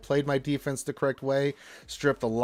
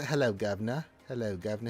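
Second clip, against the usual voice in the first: lowered mock Cockney voice; Cockney accent; 5 semitones lower, 4 dB quieter, faster than usual. Host reads a viewer's greeting, Cockney accent. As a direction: toss it off as a London Cockney.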